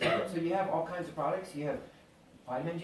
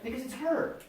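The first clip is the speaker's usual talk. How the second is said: normal talking voice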